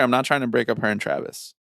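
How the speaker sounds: normal talking voice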